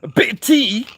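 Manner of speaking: loud, very high-pitched English parody; mock English caricature. Streamer mocking the English over tea, high loud caricature. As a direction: squeal it as a silly tea-drinking Englishman. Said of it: "If you're English".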